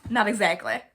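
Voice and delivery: lightly put-on Southern accent. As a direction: answer in a Southern US accent, a bit stronger. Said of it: "I'll do Southern".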